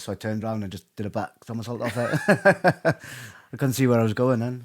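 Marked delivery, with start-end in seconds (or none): 2.2-3.3 a big burst of laughter; hearty laugh. Real, not acted. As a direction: laugh out loud at yourself. Laughs at his own story.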